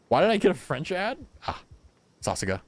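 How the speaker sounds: ordinary talk, nothing marked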